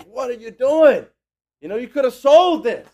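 loud, high-pitched mock outrage; upset scolding. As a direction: scold her as the upset onlookers. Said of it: "upset over what she's doing".

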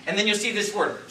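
ordinary delivery

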